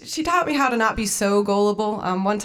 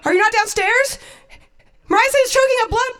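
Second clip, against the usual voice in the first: high, urgent acted questioning; frantic daughter; 6 dB louder, faster than usual. Daughter phones mom about the dog, frantic and high. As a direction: press mom in mock panic.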